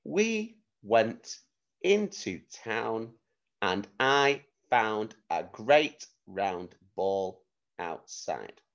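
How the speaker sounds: very slow, with gaps between words; slow dictation, pausing after each chunk; slow dictation with pauses. Shown as a demonstration. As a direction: give them time to write each word. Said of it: "One more time".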